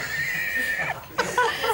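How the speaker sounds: squealing laugh; high burst of laughter. Real, not acted. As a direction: breaks into a high giggle. Host laughs at herring picture.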